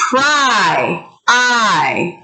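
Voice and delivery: "pry, I" drawn out, each falling in pitch; isolated vowel sound. Shown as a demonstration. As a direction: stretch the long I in "pry, I". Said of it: "decide which vowel goes where".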